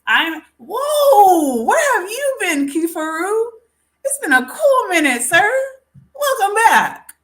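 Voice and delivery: high, loud, delighted welcome; light up to greet a regular; a real burst of joy to see him; excited greeting. Real, not acted. Greeting a returning viewer.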